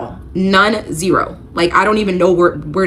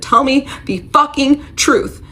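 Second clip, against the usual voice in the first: higher, slow, forceful retold yell at her husband; slow, pitched-up demand, half-performed; retold scream; 8 semitones higher, slower than usual. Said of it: "and I scream in his face".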